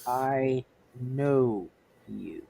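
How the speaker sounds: low, slow, deliberate villain line; low, measured in-character recognition; Namor eyeing the torch. Acted. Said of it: "looking up at at the torch and going".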